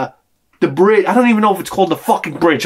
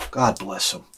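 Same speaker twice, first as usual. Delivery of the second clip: breathy whisper after a sigh, much softer; whispered blessing; 9 semitones lower, 9 dB quieter than usual. Real, not acted. Sigh, then a hushed blessing.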